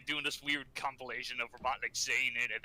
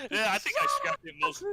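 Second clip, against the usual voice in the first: laughing; 4 dB louder than usual. Real, not acted. Cracking up, then restarts the sentence.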